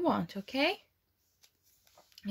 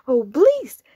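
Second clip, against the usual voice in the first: louder grinning plea; happy pig; 2 semitones higher, 9 dB louder than usual. Acted. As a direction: say it with a big happy grin. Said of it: "says the pig with a happy grin".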